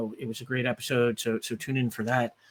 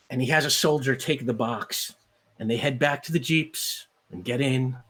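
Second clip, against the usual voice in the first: ordinary talk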